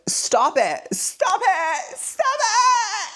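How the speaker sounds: building from mild to a shrill mock cry; panicked Carlisle. Carlisle pulling Edward off Bella, building panicked mock cry. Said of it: "Carlisle's like".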